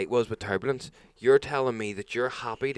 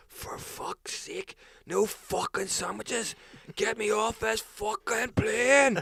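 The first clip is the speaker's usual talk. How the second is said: retold outburst, pitched way up; losing it; 12 semitones higher, much slower than usual. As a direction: mimic a passenger blowing up, stretching each phrase. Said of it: "And he just lost".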